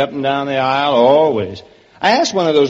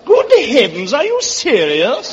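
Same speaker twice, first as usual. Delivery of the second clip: put-on incredulous upper-crust English accent; 3 semitones higher than usual. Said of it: "that's a wonderful English accent".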